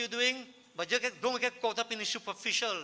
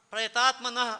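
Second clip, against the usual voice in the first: "Prayatatmanaha" said higher and louder; emphasized word; 3 semitones higher, 7 dB louder than usual. Real, not acted. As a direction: punch out "Prayatatmanaha" as the key word. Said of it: "I like to emphasize that last word".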